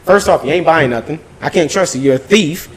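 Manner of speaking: ordinary talk, nothing marked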